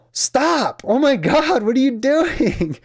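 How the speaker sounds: high, loud panicked mother's cry; alarmed mom. Retold or imitated. Mom sees son pulling teeth.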